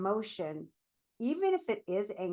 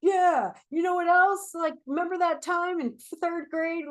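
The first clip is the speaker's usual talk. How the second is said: high and much louder, worked-up inner rant; ranting angry thoughts; 9 semitones higher, 8 dB louder than usual. Retold or imitated. Mind spiraling on a grudge, a loud high rant. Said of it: "thoughts that are going to feed that loop".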